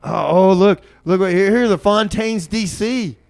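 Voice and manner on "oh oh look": ordinary talk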